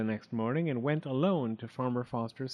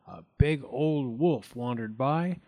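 ordinary delivery

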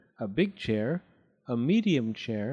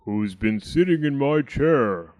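loud, big bear voice; deep Daddy Bear voice; 6 dB louder than usual. A narrator's reading. Daddy Bear finds his chair disturbed, deep-voiced. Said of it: "said in a deep voice".

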